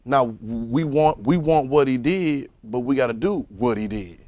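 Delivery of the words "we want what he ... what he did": usual speaking voice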